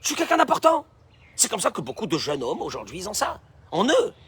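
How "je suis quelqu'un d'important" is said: pitched up high, smug and proud; puffed-up boast. Pretend. Preacher mimicking a conceited man's boast, pitched high. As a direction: play the self-important man bragging. Preacher voices the self-important man.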